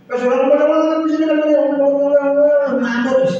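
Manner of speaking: high, sing-song mock of nonstop talking; teasing imitation of a chatterbox. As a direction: parody someone who never stops talking. Mocking endless talking.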